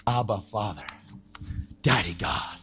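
low, hushed address to a father; soft cry to God. Acted. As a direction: say it softly and low, as intimate prayer. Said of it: "and he cries out".

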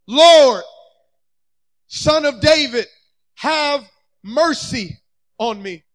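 loud, drawn-out plea, easing at the end; crying out. Acted. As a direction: call out for mercy, letting it fade. Acts out blind men calling Jesus.

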